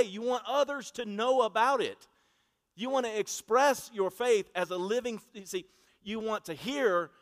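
loud, driving sermon voice; preaching at full force. Acted. Preacher urging his church to put faith on display, loud. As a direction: press the point home to the pews.

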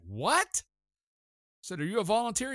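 high, rising astonished question; surprised disbelief. Retold or imitated. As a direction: gasp it out, can't believe it. Stunned by the free drinks.

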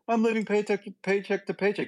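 high mocking whine; whiny complainer. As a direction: mimic a self-pitying spender. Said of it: "they're like".

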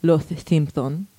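slow Castilian lisp, "Los Simpson" with th for each s; Castilian Spanish accent. Shown as a demonstration. Host showing how Spain says the title, lisped Castilian Spanish accent. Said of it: "So in Spain, it's".

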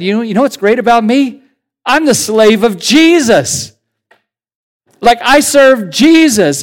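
Paul proudly boasting he serves Jesus; excited Paul. Retold or imitated. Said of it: "he was excited about that".

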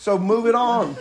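normal talking voice

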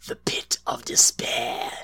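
raspy creepy whisper, slow. Retold or imitated. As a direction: hiss it like a movie villain. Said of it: "all I could think of".

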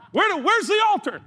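mock-overwhelmed high squeal, laughing; voice goes up. Awed preacher responds to driving talk, mock high voice. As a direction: pitch way up like an awestruck fan. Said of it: "my voice always goes up".

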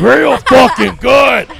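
loud, high, slow drawn-out bellow; hammed-up emphatic shout. Host echoing a guest's advice, hammed-up shout. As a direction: stretch it out and belt it. Exaggerated echo of Real good.

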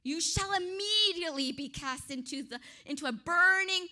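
loud warning building to a boom; emphatic royal threat. Acted. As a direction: punch it home as the threatening king. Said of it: "He's making sure you understand".

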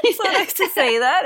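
laughing high and loud. Real, not acted. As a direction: laugh while saying it. Laughing reply to namesake.

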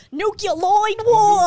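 put-on Nucleoid war! shouted high and slow in a thick accent; heavy-accented movie villain yelling Nucleoid war!. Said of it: "'cause he says it so".

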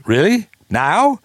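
surprised. Retold or imitated. Gene surprised at request.

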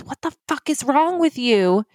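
mild high-pitched mock scream; retold scream. Retelling the woman's reaction to scalding water, mock scream. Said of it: "she just screams".

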